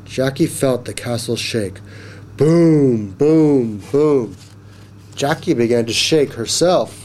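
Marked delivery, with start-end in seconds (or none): 2.4-4.4 emphatic falling booms, easing slightly; loud booming. A narrator's reading. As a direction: pound out giant footsteps. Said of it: "there was a loud sound".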